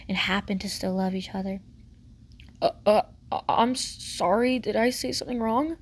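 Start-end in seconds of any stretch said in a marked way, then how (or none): 2.6-5.8 mildly panicked, stammering apology; understated panic with halting starts. A narrator's reading. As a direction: stumble anxiously into an apology. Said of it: "said in a panicked voice".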